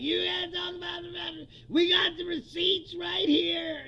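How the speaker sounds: mock shouting host. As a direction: ham up the pundit's outraged yelling. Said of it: "and he was like".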